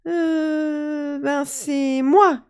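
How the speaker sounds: high, slow, hesitant fairy confession; sheepish fairy. Acted. Little fairy confessing to her mother, high and slow. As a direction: admit it reluctantly. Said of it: "lui répond la petite fée".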